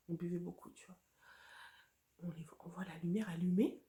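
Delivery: tense storytelling whisper. Real, not acted. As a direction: hush it as if still sneaking in the dark. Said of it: "je sais même pas pourquoi je parle doucement".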